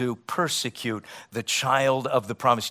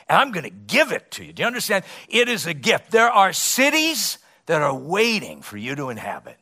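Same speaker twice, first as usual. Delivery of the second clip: climbing higher and louder, slowing on the last words; rising preaching; 8 semitones higher, 6 dB louder than usual. Acted. Preacher painting the promised land to his church, building to a peak.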